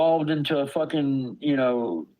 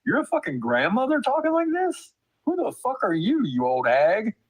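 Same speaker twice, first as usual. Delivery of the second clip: high-pitched, disbelieving retort; indignant, incredulous questioning; 9 semitones higher than usual. Retold or imitated. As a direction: retell your disbelief, pitch leaping up. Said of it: "I just was incredulous".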